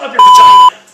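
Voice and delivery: screamed at the viewers as the rant's punchline; screaming outburst. Real, not acted. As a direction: explode at the punchline. Comic outburst climaxing the rant.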